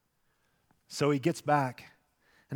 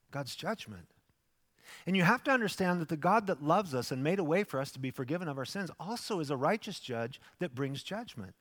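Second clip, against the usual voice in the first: ordinary talk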